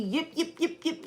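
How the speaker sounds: high, soft yip yip yip mocking the sister; mock yapping. Mocking her sister's nagging with high yips. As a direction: yap like a little dog, mocking her.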